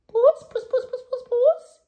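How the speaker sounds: very high, loud sing-song cat call; old man calling for a cat. Acted. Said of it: "he called".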